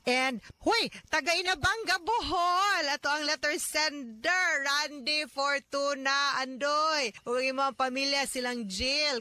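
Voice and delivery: high, bright greeting call; excited shout-out. Real, not acted. Host greeting the letter sender from Bohol, excitedly. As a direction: hail the listener's hometown with delight.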